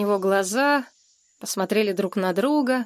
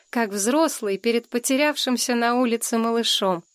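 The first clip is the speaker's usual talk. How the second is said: usual speaking voice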